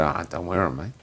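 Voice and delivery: low, soft, offhand brush-off; understated laid-back reply; casual refusal. Retold or imitated. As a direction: shrug it off quietly. His casual reply to the yelling.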